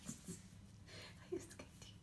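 soft teasing whisper to the puppy. Real, not acted. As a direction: breathe it playfully.

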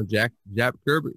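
normal talking voice

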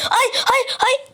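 high startled cries of alarm; panicked yelps. Real, not acted. Gamer yelping as the enderman closes in, panicked. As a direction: yelp high as it lunges.

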